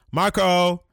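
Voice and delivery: loud calling out across a park; calling Marco. Retold or imitated. Mother calling her son loudly. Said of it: "Accent and all".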